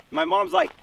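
ordinary delivery, nothing marked

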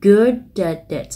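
slow "good at it" with the t flapped, like "good-ad-it"; American-style good at it; American linking. Shown as a demonstration. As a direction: flap the t in "at it". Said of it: "nối âm theo kiểu Mỹ".

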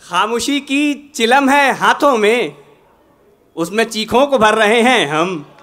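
measured, projected ghazal recitation with pauses; poetry recitation. Acted. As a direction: recite the couplet to a mushaira audience.

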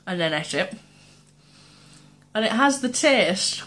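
bites between words; snack held at lips; talking while chewing. Real, not acted. Seen 2: holds stick at mouth, bites at 11.7 and 15.0, talks between bites.

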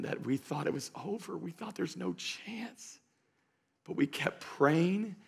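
very soft, slowed-down testimony; hushed, moved parent. Retold or imitated. Parents tell the pastor about their child's return, hushed and moved. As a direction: imitate a parent speaking almost under the breath, slowly.

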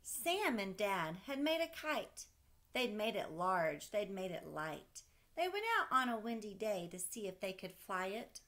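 put-on lively read-aloud voice for a child; storybook reading. Parent reading a kite story to a child, lively read-aloud. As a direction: read it like a bedtime picture book.

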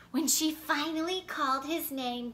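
plain reading voice

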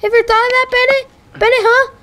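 high-pitched, exasperated telling-off; raging rant. Real, not acted. Player snapping at the game's talkative narrator, raging rant. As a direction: rant back at him, pitch way up. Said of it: "now I'm raging".

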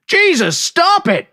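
high frantic yell to stop. A narrator's reading. Bystander yelling at the narrator to stop. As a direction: shout him off the man. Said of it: "somebody yelled".

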